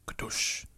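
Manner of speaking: whispered imitation of the Netflix logo sting; Netflix intro sound. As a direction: breathe out the Netflix opening sound. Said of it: "That, that sound that plays every Netflix episode".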